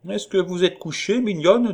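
put-on high, coaxing old colonel; calling through a door. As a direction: call out sweetly to someone in another room. Colonel calls through her closed door.

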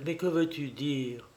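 soft, pleading question; imploring. A narrator's reading. Wife implores her horrified husband, softly pleading. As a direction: plead quietly for an explanation. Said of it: "implora Élisabeth".

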